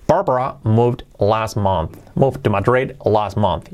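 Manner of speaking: slow, carefully separated English for learners; slow model reading. Shown as a demonstration. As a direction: model the sentence slowly, word by word. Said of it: "pues lo podéis practicar con eso".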